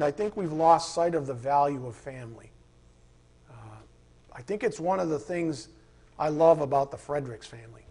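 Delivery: ordinary delivery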